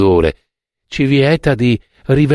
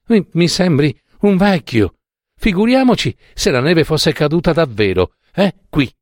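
put-on higher girlish voice, playful teasing; lightly voiced girl mocking a cold old man; girl teases the chilled narrator, playful; teasing young girl; 6 semitones higher than usual.

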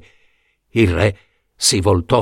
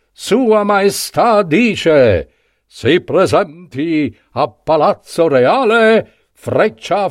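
town crier; 5 semitones higher, slower than usual. Acted. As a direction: call it out like a herald in the square. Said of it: "uscirono a cavallo dal palazzo reale i banditori".

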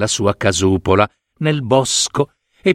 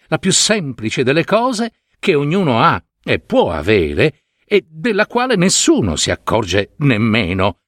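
ordinary delivery

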